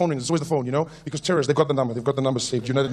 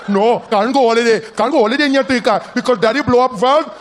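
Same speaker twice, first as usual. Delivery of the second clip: lightly voiced Indian father; a father scolding his kids in an Indian accent; Indian dad accent; 2 semitones higher than usual. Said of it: "Rajesh ... his wife".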